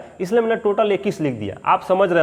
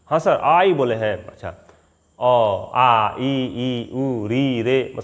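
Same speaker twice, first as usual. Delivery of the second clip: lightly voiced nervous student, slow mumbled vowels; mock a beginner reciting; imitating a fresher; 5 semitones lower, much slower than usual. A teacher mimics a new student reciting vowels. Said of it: "fresher लड़का है उससे आप कहिए".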